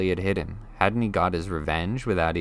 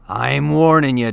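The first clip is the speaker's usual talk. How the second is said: booming threat, umbrella raised; loud warning; 6 semitones higher, 9 dB louder than usual. Acted. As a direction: bellow it like a giant. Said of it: "he said".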